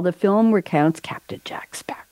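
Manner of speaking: usual speaking voice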